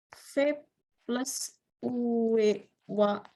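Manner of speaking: very slow, drawn-out syllables; writing while speaking. Real, not acted. Teacher writing an example on the board, drawn out. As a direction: stretch each syllable as you write.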